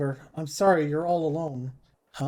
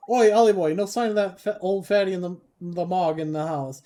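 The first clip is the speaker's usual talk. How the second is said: cheeky Welsh-ish sidekick shouting out, higher and louder; loud cheeky fairy; 3 semitones higher, 6 dB louder than usual. Acted. Fairy companion calls out.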